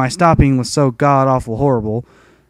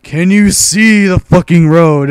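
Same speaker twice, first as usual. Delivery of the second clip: slow, louder mocking question; mock instructor; 4 semitones higher, 5 dB louder, slower than usual. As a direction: drag it out like a dumb question from the examiner. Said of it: "Ask me other questions like".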